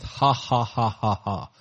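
gloating ha ha ha, lightly acted; mocking laughter. Preacher voicing the enemies laughing at Jerusalem, gloating laugh. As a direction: laugh like the enemy sneering at ruins.

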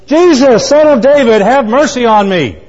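high, loud acted plea for mercy; crying out. Blind man calls to passing Jesus, crying out loudly. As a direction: shout it out to reach someone passing by. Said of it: "he cries out and he says".

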